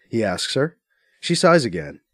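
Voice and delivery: usual speaking voice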